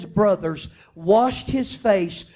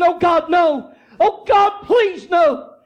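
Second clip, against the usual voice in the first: loud, high, desperate cry of Oh God, no; anguished pleading; 8 semitones higher, 5 dB louder than usual. Acted. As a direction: beg like the brothers watching Benjamin taken. Brothers see the cup with Benjamin.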